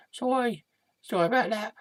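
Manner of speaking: small, high, soft voice; chastened apology. Acted. Sidekick told to calm down, meek little apology. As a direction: apologise meekly, high and hushed. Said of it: "Calm down".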